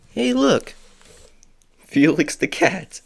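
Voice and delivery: delighted discovery, pitch jumps up; happy surprise at a find; excited. Real, not acted. Spots Felix on candy.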